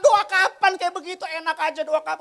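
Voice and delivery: loud, high indignant retort; angry protest. Retold or imitated. Pastor arguing angrily with God, loud indignant retort. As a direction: snap back in mock outrage. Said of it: "Terus saya marah".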